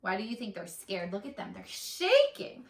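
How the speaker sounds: usual speaking voice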